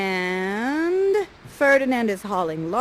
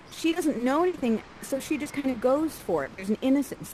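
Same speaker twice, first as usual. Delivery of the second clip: ordinary talk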